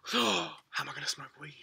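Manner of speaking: breathless mock horror; mock panic whisper. Mock panic over smoking.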